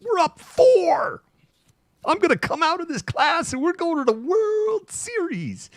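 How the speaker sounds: high, breathy, cocky hype; very high-pitched mock confidence; giddy fan boast. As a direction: squeal it like it's already won.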